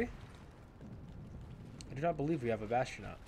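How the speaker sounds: slow, soft deadpan aside; quiet slow sarcastic muttering; deadpan sarcasm. Real, not acted. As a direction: state it flatly, mocking the obvious.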